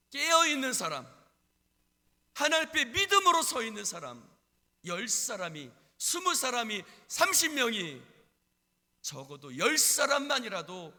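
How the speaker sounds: loud, high proclamation; preaching at full force. Real, not acted. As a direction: hammer out each phrase loudly. (크게 외치는 설교조)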